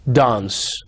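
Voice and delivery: RP "dance" with a broad ah vowel, /dans/; British RP. Shown as a demonstration. Teacher contrasting RP "dance" for students. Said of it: "R P".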